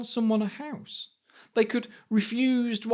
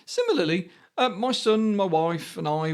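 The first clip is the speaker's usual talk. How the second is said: ordinary delivery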